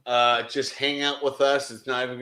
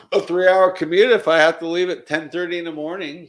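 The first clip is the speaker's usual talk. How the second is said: higher and louder, keen to come; eager coworker voice, raised; 5 semitones higher, 6 dB louder than usual. Retold or imitated. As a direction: sell the long drive with excitement. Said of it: "She's like".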